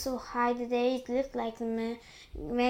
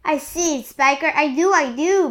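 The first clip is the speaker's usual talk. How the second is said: loud, raised-pitch exclamation; excited shouting; 4 semitones higher, 11 dB louder than usual. A narrator's reading. One aunt answers the other about the peach, excited shouting. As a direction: shout back eagerly.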